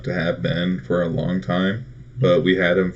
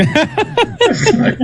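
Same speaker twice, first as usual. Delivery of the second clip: laughing; 6 dB louder than usual. Real, not acted. Laughing while telling the story.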